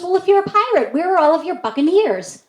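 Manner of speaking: pointed grown-up question, high; high, loud homeowner voice. Retold or imitated. Homeowner quizzing the pirate kid, high and loud.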